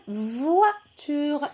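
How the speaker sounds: VOITURE stretched into two slow syllables; drawn-out syllables. Shown as a demonstration. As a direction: sound out "voiture" slowly, syllable by syllable. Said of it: "Est-ce que dans le mot voiture".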